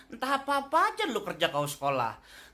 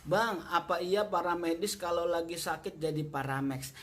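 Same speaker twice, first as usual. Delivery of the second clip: low question-reading voice; 7 semitones lower, 4 dB quieter than usual. Acted. Reads viewer question.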